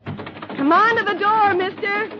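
high, slow call through the glass; calling through door. Acted. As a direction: call out to the man inside. Calling out to the manager.

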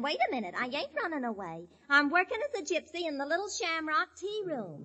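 usual speaking voice

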